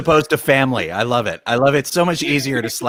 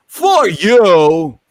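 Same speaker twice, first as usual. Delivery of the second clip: louder, higher, oddly paused "For you" like Walken; a light stab at Walken's halting style; hint of Walken's clipped cadence; Christopher Walken impression; 6 semitones higher, 8 dB louder than usual. Shown as a demonstration. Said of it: "A Christopher Walken delivery".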